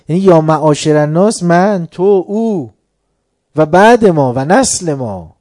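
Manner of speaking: loud, impassioned sermon climax; full-force preaching. Acted. Preacher urges the congregation to honor Ali, loud impassioned. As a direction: preach it out loud to the whole hall.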